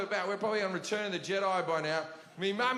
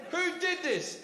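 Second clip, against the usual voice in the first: high-pitched mock interrogation; high, exasperated parental question; demanding mother; 9 semitones higher, slower than usual. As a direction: imitate a mother demanding the culprit.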